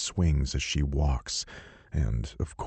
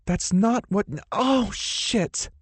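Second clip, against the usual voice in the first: much higher sudden yelp; yelping heroine; slower than usual. Acted. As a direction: cut off mid-line by pain. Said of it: "she yelps".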